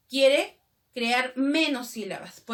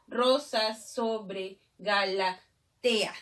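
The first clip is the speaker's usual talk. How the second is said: very slow, segmented verse reading; measured scansion with pauses between chunks; syllable by syllable; 2 semitones lower, much slower than usual. Shown as a demonstration. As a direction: break the verse into separate syllables. Teacher counts syllables of verse.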